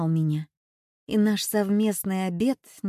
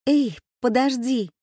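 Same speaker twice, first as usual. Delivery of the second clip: raised-voice call, pitched up; 4 semitones higher, 4 dB louder than usual. A narrator's reading. Girl calling the man back as he leaves the queue, calling out. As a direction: call after someone leaving, moderately loud and higher. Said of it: "И тут я выкрикнула".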